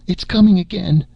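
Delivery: high panicked scream of warning; terrified scream. Acted. Marsha screaming at the crew as the snake comes, terrified. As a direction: scream it in terror, pitch way up. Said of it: "screamed Marsha".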